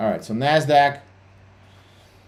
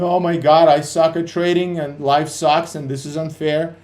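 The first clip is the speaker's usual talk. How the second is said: mock despair; 5 semitones higher, 4 dB louder than usual. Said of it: "when you're all depressed".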